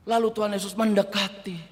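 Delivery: usual speaking voice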